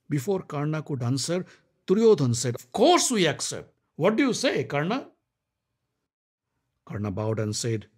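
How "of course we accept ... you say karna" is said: higher, eager prince; Duryodhan's voice. Acted. Duryodhan pressing Karna to agree, eager. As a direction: jump in eagerly.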